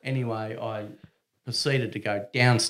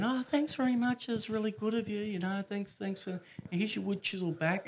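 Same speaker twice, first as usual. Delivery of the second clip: higher-pitched woman's voice, sweetly thankful; 11 semitones higher than usual. Retold or imitated. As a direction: play the grateful lady upstairs, up high. Voicing the woman thanking him.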